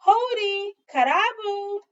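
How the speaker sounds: Hodi, karibu called high and loud; a bright call at the door; calling greeting. Acted. Child greets at the wood shop, high and loud.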